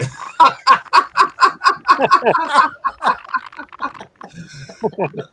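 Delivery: long burst of high laughter; loud high laugh. Real, not acted. Laughing at cupboard joke.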